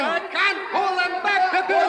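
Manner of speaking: high, strained cry for help; strained call. Acted. Crewman crying to the captain as the mob pushes, strained. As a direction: call out while holding back the mob. Struggling with panicked crew.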